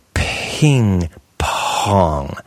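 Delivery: PING PONG, each P blown out long; exaggerated puffed P. Shown as a demonstration. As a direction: puff both Ps in ping pong hugely. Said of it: "You puff the P".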